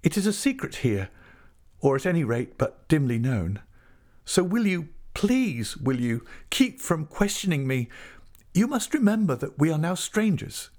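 high, urgent appeal; pleading. A narrator's reading. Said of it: "she pleaded".

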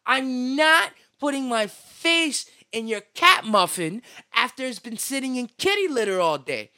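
loud, emphatic, disgusted refusal; loud disgusted rant. Real, not acted. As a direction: shout it in disgust.

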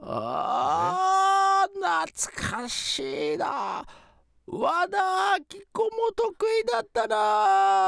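put-on high, loud old ghost; shouting ghost. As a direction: boom out nostalgic ghost grandpa. Ghost grandfather reminisces loudly.

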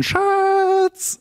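high-pitched imitated yell from the back seat; mock alarmed shout of a name. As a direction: cry out like a girlfriend startled by the crash. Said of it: "schrie von hinten".

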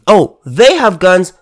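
ordinary talk, nothing marked

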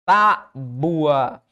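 "Tábua" as TÁ, then bua kept together; syllable split. Shown as a demonstration. Teacher showing U and A stay together, "Tábua" split TÁ-bua. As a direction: stress TÁ in "Tábua" and draw out bua as one syllable. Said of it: "esse U seguido de A aqui fica junto".

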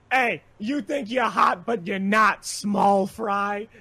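quoted insult. Retold or imitated. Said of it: "This was someone's actual words".

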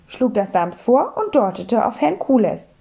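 plain reading voice

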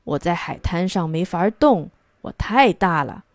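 usual speaking voice

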